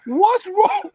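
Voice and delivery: high, laughing imitation of his sister; mock-alarmed sister, high and giggly; sister's alarmed question. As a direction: squeak it out like a startled little sister. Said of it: "She goes".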